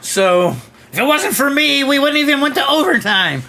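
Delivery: high-pitched mock whining hockey show-off; hammed-up puck hog bragging; boastful puck hog. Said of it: "The puck hog, man. He's".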